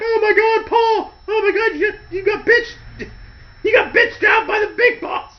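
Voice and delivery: very high, shocked squeal; mock shocked shriek. Family member shrieking at the beaten Big Show, high. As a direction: shriek with laughing disbelief. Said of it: "his family member's like".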